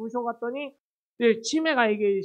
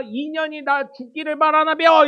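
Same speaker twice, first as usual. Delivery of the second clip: louder, higher hammed-up temper fit; mock angry outburst; 6 semitones higher, 9 dB louder than usual. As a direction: imitate an angry mother-in-law blowing up. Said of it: "막 승질을 냈다는".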